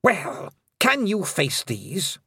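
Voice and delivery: high, slow threat; slow menacing queen; queen's cold challenge. Acted. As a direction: dare them coldly.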